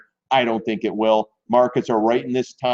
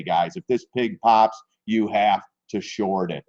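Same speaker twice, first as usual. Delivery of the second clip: ordinary delivery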